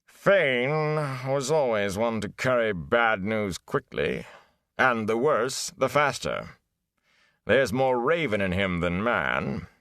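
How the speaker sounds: put-on slow, scornful old bard, voice high on the name. A narrator's reading. Gleeman sneers at the peddler to the village youths, scornful. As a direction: spit the name, then sneer slowly. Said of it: "replaced by scorn".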